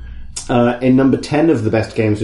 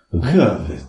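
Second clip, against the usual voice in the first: low solemn pronouncement; low grave voice; 5 semitones lower than usual. Pretend. Game voice imitation.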